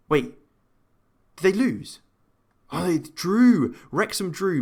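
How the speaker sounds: high-pitched, amazed discovery; happy surprise. Real, not acted. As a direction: react live to a rival dropping points. Reading surprising rival result.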